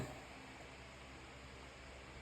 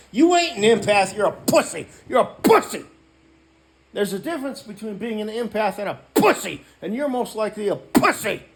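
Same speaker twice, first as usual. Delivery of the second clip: higher, loud scolding tirade; angry berating; 7 semitones higher, 8 dB louder than usual. Real, not acted. Vlogger scolding a viewer who claims to be an empath, with loud angry contempt. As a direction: tear into the so-called empath.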